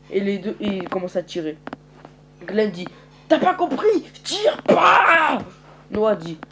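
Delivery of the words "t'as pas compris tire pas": high, loud shout to stop; angry yell. Acted. Glenn yelling at the group to hold fire, loud. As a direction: bark at them to stop firing.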